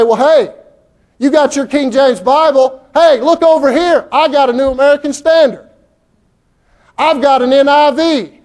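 mock someone bragging about their Bible versions; mocking Bible collector. Preacher mocking a churchgoer showing off his translations, high and loud. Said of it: "You say".

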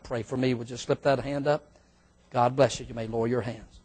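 low, soft altar-call voice; hushed pastor's invitation prayer; closing prayer. Real, not acted. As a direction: pray gently over the bowed heads.

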